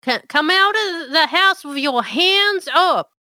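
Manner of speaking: loud, high barked police order; shouting deputy. Acted. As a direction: yell it like a cop at the door. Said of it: "and he says".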